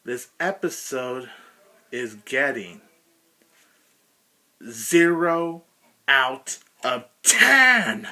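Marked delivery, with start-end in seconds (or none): 4.7-8.1 dramatic verdict. Real, not acted.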